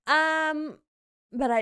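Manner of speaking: normal talking voice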